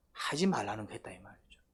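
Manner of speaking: hushed, breathy emphasis; whisper. Real, not acted. Preacher hushing the congregation, whispered. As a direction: lean in and whisper it.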